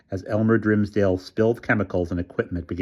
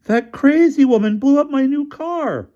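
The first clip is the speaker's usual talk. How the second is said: very high, louder outcry; furious rant; 7 dB louder than usual. A narrator's reading. Developer howling at the burning car, very high. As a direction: rage at the loss.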